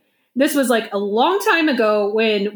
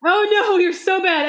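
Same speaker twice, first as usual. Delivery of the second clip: higher, mock-dismayed reaction; retold dismay; 8 semitones higher than usual. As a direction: act out a friend's alarm lightly. Retelling friend's panicked reaction to prank.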